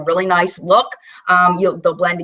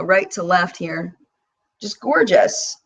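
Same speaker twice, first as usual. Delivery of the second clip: ordinary delivery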